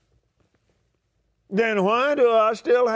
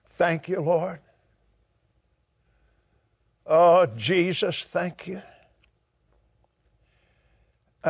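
worship prayer; 3 semitones lower than usual. Acted. Preacher modelling thanks to God, worshipful prayer. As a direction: pray it gently, thanking Jesus.

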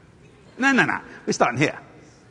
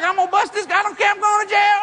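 high, loud yell; shouted threat; 11 semitones higher, 3 dB louder, faster than usual. Retold or imitated. The man shouts down the dealer, high and loud. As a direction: shout that he doesn't care.

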